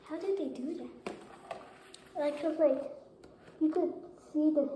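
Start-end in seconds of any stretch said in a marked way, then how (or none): 2.2-4.7 muffled behind plastic mask; speaking inside costume mask; through a face mask. Real, not acted. Seen 2: Hard full-face mask on from 0.1 s, removed by 3.7 s.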